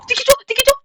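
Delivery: high, loud startled yell; frightened shout. Real, not acted. Streamer yelling at a strange creature in fright.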